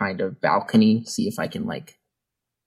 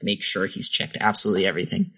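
usual speaking voice

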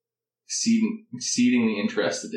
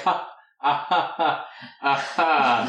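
higher-pitched, stilted fake laughter; forced laugh; 5 semitones higher than usual. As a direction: force out an awkward social laugh. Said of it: "force a laugh".

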